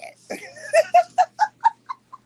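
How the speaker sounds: piercing high cackle; high shrieking laugh. Real, not acted. Laughing at the other.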